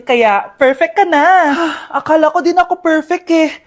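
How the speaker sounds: ordinary talk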